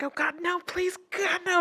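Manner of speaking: high, soft pleading; mock tearful plea. Fighting off the floodgates, high soft mock plea. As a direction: plead in a small high voice against the tears. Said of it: "the nose starts tingling".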